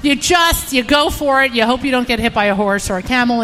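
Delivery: ordinary delivery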